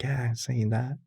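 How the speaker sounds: put-on a weak, half-hearted British accent as Steven; poor Steven accent. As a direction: try Steven's British accent and miss it a bit. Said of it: "That's my poor Steven accent there".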